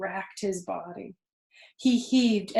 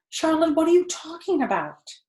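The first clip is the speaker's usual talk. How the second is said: higher and louder, pleading in alarm; alarmed cry; 9 semitones higher, 5 dB louder than usual. A narrator's reading. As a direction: cry out, frightened by her words. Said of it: "he cried".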